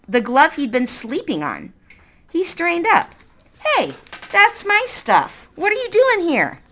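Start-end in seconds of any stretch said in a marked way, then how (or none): 3.8-6.6 put-on boy protests in alarm, pitched up; boy's alarmed voice. Peter voiced in narrated story.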